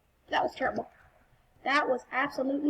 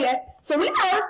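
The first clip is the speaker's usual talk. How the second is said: voice crack; 5 semitones higher, 5 dB louder than usual. Real, not acted. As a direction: voice cracking up high. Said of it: "that voice crack".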